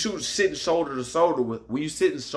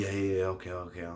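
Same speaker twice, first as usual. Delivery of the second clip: dismissive mumble; 6 semitones lower, 8 dB quieter, faster than usual. Retold or imitated. Old man brushing him off.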